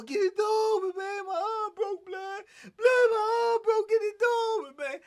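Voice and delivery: high, loud, crying plea through the door; desperate pleading. Retold or imitated. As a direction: beg to be let out, half sobbing. Banging on door, arm broken.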